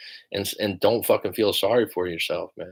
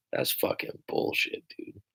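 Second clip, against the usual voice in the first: hushed scoff; 10 dB quieter than usual. Real, not acted. Dismissing the statement.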